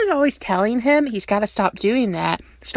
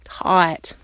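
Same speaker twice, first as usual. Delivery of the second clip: pained; 3 semitones lower than usual. A narrator's reading. Derek forcing himself to compliment Stiles, pained. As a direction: squeeze the word out like it hurts. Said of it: "looks physically pained when he has to say the word".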